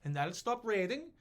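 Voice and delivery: very high, loud, sing-song voice; mock agreeable king. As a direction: cheerfully turn the tables. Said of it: "Gustavus goes".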